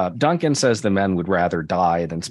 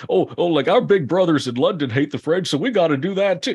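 higher-pitched mocking eagerness; mock eager follower; 9 semitones higher, 4 dB louder than usual. Host mocks Americans copying London's hatred of the French, mock-eager. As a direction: parody an over-keen little brother, pitched up.

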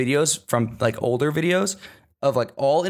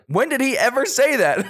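incredulous; 10 semitones higher than usual. Retold or imitated. Son retorts to mom.

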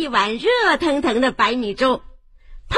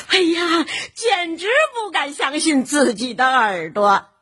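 ordinary delivery, nothing marked

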